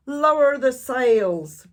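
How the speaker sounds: loud, slow, high order; shouted. A narrator's reading. Captain ordering his crew in the storm, loud shout. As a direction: bellow orders over a storm. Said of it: "Braidbeard shouted".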